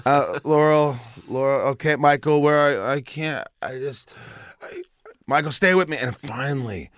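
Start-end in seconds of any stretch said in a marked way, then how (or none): 0.1-1.6 higher, slurred and vague; mock woozy patient giving a joke answer; dazed, confused answer. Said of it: "Where's your house". 1.7-5.9 higher, urgent, a touch tearful; nurse pleading. Retold or imitated. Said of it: "she looks down".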